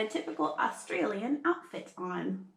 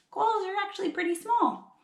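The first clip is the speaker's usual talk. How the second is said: usual speaking voice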